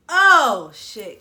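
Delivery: loud startled oh, then a dropped curse; pained outburst. Retold or imitated. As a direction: yelp it like the wax just ripped. Pain from the wax snatch.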